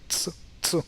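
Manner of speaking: "T" said as "tsuh", t and s together; isolated ts for "T"; ts sound. Shown as a demonstration. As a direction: say "T" as ts, not the letter name. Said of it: "it becomes like T".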